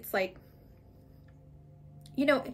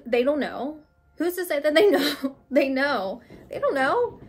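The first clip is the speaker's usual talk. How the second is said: mock protest; 4 semitones higher, 6 dB louder than usual. Real, not acted. Mock dismissive they know.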